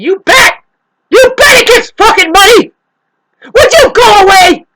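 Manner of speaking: high, full-force acted shout; hammed-up screaming rant. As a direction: scream them away in mock outrage. Acted outburst at imagined showy person.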